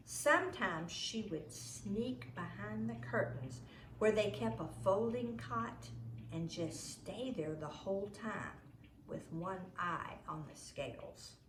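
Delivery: plain reading voice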